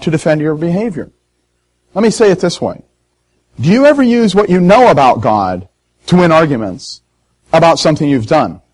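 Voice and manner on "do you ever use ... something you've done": ordinary delivery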